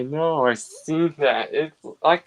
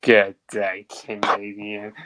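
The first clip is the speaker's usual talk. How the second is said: freight car catchphrase; 8 semitones lower than usual. Acted. Possessed freight car greets victims, low creature voice. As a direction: say it deep as the killer car.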